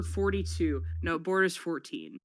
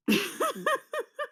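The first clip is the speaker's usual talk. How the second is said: high burst of giggling; helpless high laugh; 3 dB louder than usual. Real, not acted. Losing it over a casting joke, high laughter. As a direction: crack up completely, high and helpless.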